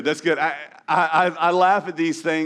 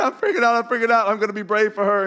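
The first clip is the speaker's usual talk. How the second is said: high-pitched playful freak-out, easing down; hammed-up nervous dad, pitched up; mock panic; 5 semitones higher than usual. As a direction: voice a panicking dad trying to be brave. Voicing nervous father in photo.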